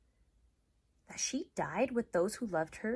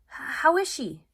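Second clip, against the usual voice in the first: higher and louder, worried question; Ivan asking; 9 semitones higher, 10 dB louder, slower than usual. Acted. Gorilla Ivan asking Bob about Ruby, worried. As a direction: ask it anxiously. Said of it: "I ask".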